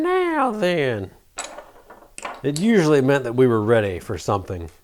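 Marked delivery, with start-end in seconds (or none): 0.0-1.1 much higher, drawn-out "now then" like a grandma; grandma's catchphrase. Retold or imitated. Vlogger quoting what his grandma used to say, high-pitched imitation. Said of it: "something my grandma used to say all the time".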